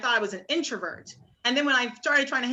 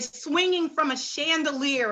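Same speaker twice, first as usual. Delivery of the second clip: ordinary talk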